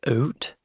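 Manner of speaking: "oot" with a long Canadian oo for "out"; imitated Canadian vowel. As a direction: mimic a Canadian saying out as "oot". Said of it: "but then he said oot".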